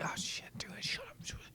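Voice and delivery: hushed, urgent whisper; panicked whisper. Retold or imitated. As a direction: hiss it so the enemy won't hear. Caught vaping, hushing squad mates.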